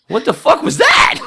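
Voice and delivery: high, loud shocked shout; high shout of disbelief at the radio. Retold or imitated. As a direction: yell it in disbelief. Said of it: "No, like".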